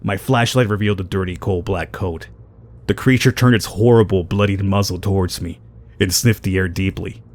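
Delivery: low, measured reading of eerie text; story narration. Acted. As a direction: read it like a grim horror tale. Reads game story text.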